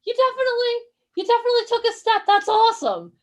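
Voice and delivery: put-on high, delighted gushing over a baby; loud, high doting parent praise; gushing mom. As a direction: coo with excitement at a first step. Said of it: "She's gonna".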